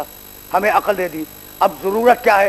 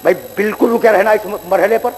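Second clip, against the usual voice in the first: ordinary talk, nothing marked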